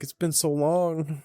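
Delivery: high, slow, drawn-out; lightly hammed nostalgia; mock longing. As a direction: sigh it out in a high put-on voice. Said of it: "it's like".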